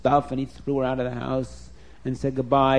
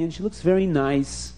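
usual speaking voice

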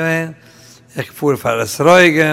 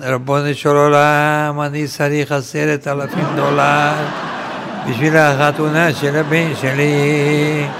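mock wailing prayer. Man begging God for dollars for his son's wedding, mock wailing. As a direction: plead tearfully on one long note, hammed up. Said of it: "in der Halbton zu weinen".

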